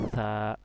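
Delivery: "সা" with tongue tip on the teeth, air hissing out; light air release. Shown as a demonstration. Teacher demonstrating after explaining tongue position, "সা" with air released. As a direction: let air out before the held vowel of "সা". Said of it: "হালকা করে বাতাস বের হবে".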